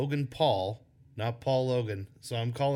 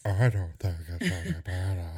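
low, goofy mimicking voice; silly voice; 5 semitones lower than usual. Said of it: "easier to do silly voices".

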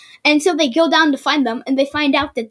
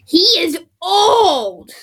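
amazed; 4 semitones higher, 5 dB louder, much slower than usual. Real, not acted. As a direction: stretch it out in disbelief. Reading birth year.

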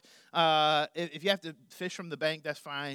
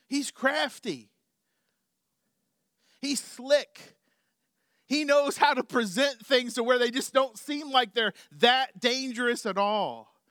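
preaching at full force; 7 semitones higher, 6 dB louder than usual. Real, not acted. Preaching about Satan's craftiness.

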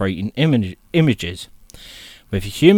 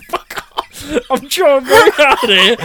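high giggly protest; laughing; 14 semitones higher, 6 dB louder than usual. Real, not acted. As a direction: laugh through the words. Laughing at friend's mockery.

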